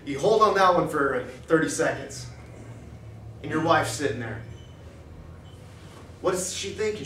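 usual speaking voice

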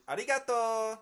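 Arigato with the final "o" lifted high. Shown as a demonstration. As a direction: bring up the end of Arigato, high-pitched. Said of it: "take that last vowel and they bring it up".

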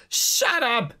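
laughing pirate voice. Acted. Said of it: "the middle guy is just ha ha ha ha guy".

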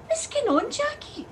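high-pitched bewildered demand; baffled alarm. Acted. Driver finds tea bag in the ashes, baffled. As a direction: voice leaping up in disbelief.

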